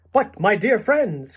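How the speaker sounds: rally your friends with forced cheer; trying to be cheerful. A narrator's reading. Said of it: "cried the old green grasshopper, trying to be cheerful".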